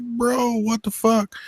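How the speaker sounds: can't believe what he's seeing; high amazed bro; disbelieving. Retold or imitated. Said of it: "I was like".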